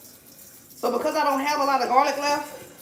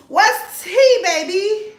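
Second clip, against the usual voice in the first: much higher and louder, calling out to viewers; playful gossip call, raised voice; loud excited call; 7 semitones higher, 8 dB louder, much slower than usual. Real, not acted. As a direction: belt "What's tea" up to the chat. Repeats question, louder second time.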